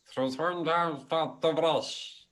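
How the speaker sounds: high-pitched, slow little creature voice; tiny voice. Acted. Game master voices a squirrel to the players, tiny high voice. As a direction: squeak it out slowly, very high. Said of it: "you hear in a tiny voice".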